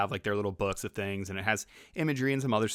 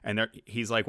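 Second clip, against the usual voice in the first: ordinary talk, nothing marked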